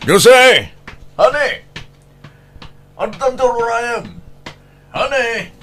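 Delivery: high calling voice; high call for honey. Acted. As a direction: call for him through the house. Wife calling out for husband.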